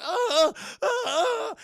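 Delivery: loud, high repeated yells; mock pain screams. Burglar having his fingers smashed, mock pain screams. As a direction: howl as if fingers are crushed.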